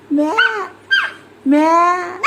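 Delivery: lightly imitated goat bleat. Shown as a demonstration. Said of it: "बकरी कशी करत होती".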